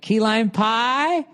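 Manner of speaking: high, slow puzzled clerk voice; clueless clerk. Retold or imitated. Grocery clerk misunderstanding him, high slow puzzled voice. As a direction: play the confused clerk, stretched out. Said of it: "They're like".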